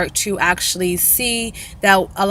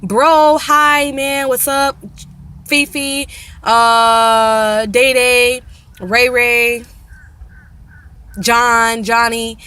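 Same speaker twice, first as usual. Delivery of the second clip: mock greetings; 3 semitones higher, 4 dB louder, much slower than usual. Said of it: "We don't say dog".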